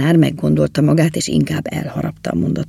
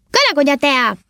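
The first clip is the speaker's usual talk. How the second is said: blaring shout; 11 semitones higher than usual. A narrator's reading. Elf shouting drink ideas from the cart, high blaring call. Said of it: "és egyre harsogott".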